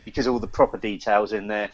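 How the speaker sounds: ordinary talk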